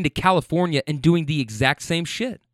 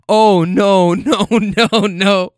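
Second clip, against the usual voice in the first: loud, higher wailing imitation; hammed-up repeated protest; mock dismay; 5 semitones higher, 7 dB louder, much slower than usual. Rider in the back still objecting, loud mock wail. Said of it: "still going".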